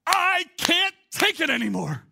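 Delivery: high, drawn-out exasperated scream; hammed-up scream, slow and high. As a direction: act out snapping at the end of your patience. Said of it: "you just scream out".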